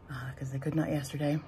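whispering under the breath; whispered aside. Real, not acted. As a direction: drop to a whisper. Unvoiced aside.

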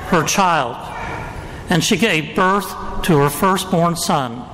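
Gospel reading. Acted. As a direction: proclaim it like a liturgical reading.

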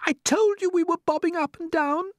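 very high, vindicated falsetto; ladybird. A narrator's reading. Ladybird proved right to the others, high exclamation. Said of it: "the ladybird said".

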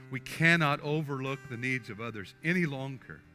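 ordinary delivery

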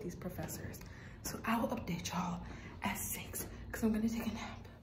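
hushed voice. Real, not acted. Quiet before nap, others near.

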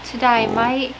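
put-on brief try at an Australian accent; amateur Australian twang; attempted Australian accent. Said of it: "No, that's not Australian".

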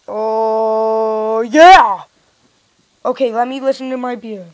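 normal talking voice

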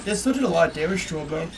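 talking through a yawn. Real, not acted. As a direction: say it mid-yawn. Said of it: "sorry, I'm yawning there again".

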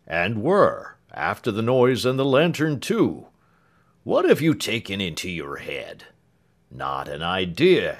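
lightly voiced, unruffled manservant; a hint of a dry, calm husband; composed Britain. A narrator's reading. Britain teasing Clemency about the lantern, lightly voiced and unruffled.

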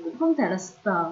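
ordinary talk

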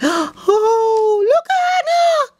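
very high, gasping shriek of delight; gasp, then squeal with joy; thrilled squeal. Real, not acted. Thrilled by next item.

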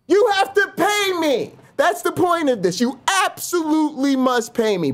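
high, cheeky demand; mock demand. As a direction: say it like a greedy joke.

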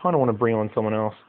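usual speaking voice

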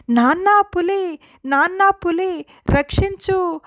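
put-on a mischievous boy hollering down the hill, faking alarm; loud prank call for help. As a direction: fake cry for help, called out slowly. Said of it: "గట్టిగా అరుస్తున్నాడట".

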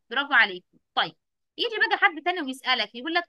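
usual speaking voice

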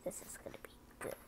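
fast hushed whisper; quick eager whisper; excited whisper. Real, not acted. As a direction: whisper it with glee.

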